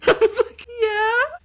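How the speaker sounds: laughing. Real, not acted. Laughing while retelling.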